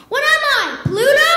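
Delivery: comic outrage at detention; indignant. Acted. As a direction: play it offended. Indignant punchline.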